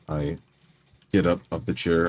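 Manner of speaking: ordinary talk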